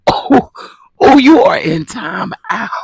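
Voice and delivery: high, loud laughing time out; laughing mock scold. Real, not acted. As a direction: laugh while sending them off.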